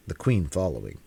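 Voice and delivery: ordinary delivery, nothing marked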